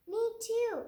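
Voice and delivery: high, loud eager me too; eager chorus. Acted. Friends eagerly agreeing with Lily, high and loud. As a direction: shout it as a keen kid. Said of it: "said her friends".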